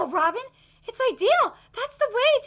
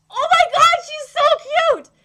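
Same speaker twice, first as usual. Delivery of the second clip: gushing fangirl squeal; 8 semitones higher, 9 dB louder than usual. Real, not acted. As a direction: squeal over how cute she is. Fangirling again.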